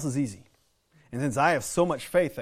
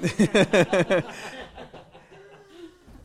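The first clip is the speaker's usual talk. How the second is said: loud, long burst of real laughter; big laugh; 8 semitones higher, 7 dB louder than usual. Real, not acted. Preacher laughing loudly at his own insider joke.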